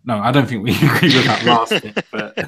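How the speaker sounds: breaking into a laugh while speaking. Real, not acted. Co-host retracting his joke about weed, laughing. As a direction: laugh through the correction. Laughs at own joking summary.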